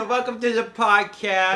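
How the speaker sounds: high, loud goofy Sandler voice; Adam Sandler impression. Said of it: "Adam Sandler impressions now?".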